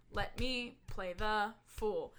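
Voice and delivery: "Let me play the fool" slow, low, stress on "me"; iambic stress. Shown as a demonstration. Actor demonstrating the pentameter stress to a friend, slow and low. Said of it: "stressed and unstressed syllables".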